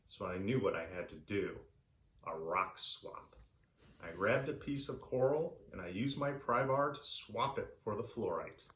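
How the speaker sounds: plain reading voice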